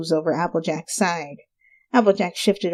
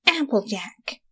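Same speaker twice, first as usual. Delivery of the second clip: soft ominous name; 4 semitones higher, 4 dB quieter, slower than usual. Acted. Rainbow eyes Applejack hungrily. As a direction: say her name like she's dinner.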